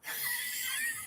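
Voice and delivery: big mock group sigh, high and breathy; imitating a whole plane deflating at once; collective sigh. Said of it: "this collective".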